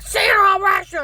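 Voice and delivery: high, very loud screamed threat; screaming. Retold or imitated. Agent repeating threat, screamed high and loud. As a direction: shriek it at her. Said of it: "He screams it again".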